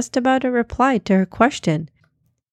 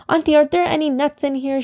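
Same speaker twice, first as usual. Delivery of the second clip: fast, high, anxious question; quickly; 7 semitones higher, faster than usual. A narrator's reading. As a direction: rush the question out. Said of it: "she asked quickly".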